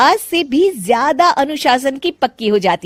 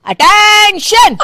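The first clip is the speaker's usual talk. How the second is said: high, drawn-out slow parade-ground shout; mother-in-law calling the house to attention; drill command; 12 semitones higher, 8 dB louder, much slower than usual. Acted. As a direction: bark it like a drill sergeant. Retired policewoman barks command.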